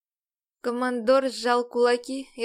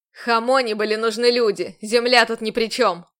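furious retort; 8 dB louder than usual. A narrator's reading. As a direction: snap back in fury. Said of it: "Яростно возразил он".

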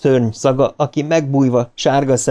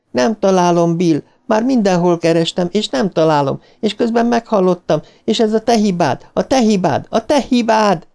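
high accusing lament, rising in pitch; dead child's high wail building into repeated blame; damned, intensifying voice; 9 semitones higher than usual. A narrator's reading. Dead George blames his brother Bill, high accusing wail. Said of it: "George elkárhozott hangja egyre erősebb lett".

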